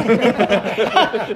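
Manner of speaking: ordinary delivery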